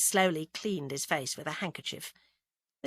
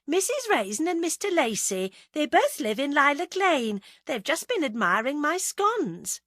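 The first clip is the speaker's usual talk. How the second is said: high, chirpy cover-up; nervous wife; 8 semitones higher, 4 dB louder than usual. A narrator's reading. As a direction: introduce guests too sweetly, pitched well up. Nervous wife introduces guests to husband.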